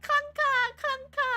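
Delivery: high, loud mocking chant of the word; mocking yell. The crowd taunting the clankers, high loud jeer. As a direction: belt it out high and jeering. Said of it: "they were like".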